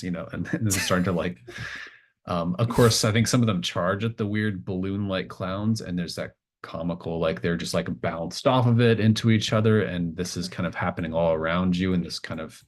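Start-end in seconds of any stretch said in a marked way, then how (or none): none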